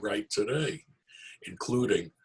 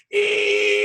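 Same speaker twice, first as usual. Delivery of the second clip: high loud creaky squeal; squealing door; 9 dB louder than usual. Acted. Door creaks as Herschel opens it, high squeal. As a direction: squeal like rusty hinges. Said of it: "Rusty hinges squealed".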